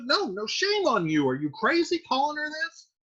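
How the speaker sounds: scolding mother. Retold or imitated. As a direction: chide him in disbelief. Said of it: "It's like".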